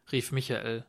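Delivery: ordinary delivery, nothing marked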